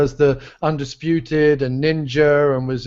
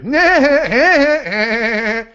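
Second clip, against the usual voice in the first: high, loud cartoon woodpecker cackle; Woody Woodpecker laugh; 8 semitones higher, 4 dB louder than usual. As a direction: show off the cartoon-bird laugh once more. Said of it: "That's another impression that I do".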